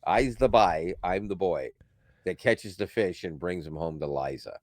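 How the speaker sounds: put-on lightly sung Newfoundland accent, "by" for boy; half-sing it in thick Newfoundland dialect. Reciting a Newfoundland folk song in local accent. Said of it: "It sounds like this".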